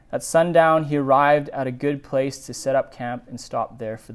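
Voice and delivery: steady, measured Bible reading; scripture reading. A narrator's reading. Pastor reading Genesis aloud to the congregation, measured. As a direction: read the passage evenly to the congregation.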